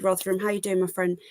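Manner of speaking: normal talking voice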